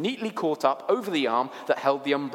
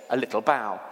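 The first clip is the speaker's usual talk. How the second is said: ordinary delivery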